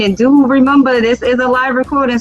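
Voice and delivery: normal talking voice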